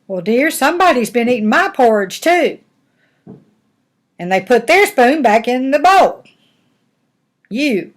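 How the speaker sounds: high, loud, indignant mother bear; storybook mama bear, affronted. Acted. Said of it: "And Mama Bear said".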